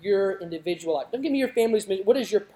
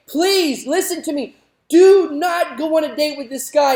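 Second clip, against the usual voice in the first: father begging, raised and urgent; pleading father; 9 semitones higher, 8 dB louder than usual. Retold or imitated. Father begging his daughter not to date the playboy, urgent and raised. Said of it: "the father again pleading and saying".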